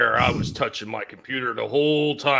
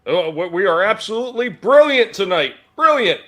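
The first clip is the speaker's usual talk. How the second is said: excited; 6 semitones higher, 7 dB louder than usual. Real, not acted. Greets the host with gusto.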